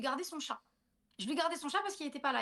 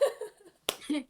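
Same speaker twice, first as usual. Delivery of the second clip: laughing; 6 semitones higher than usual. Real, not acted. Laughing after punchline.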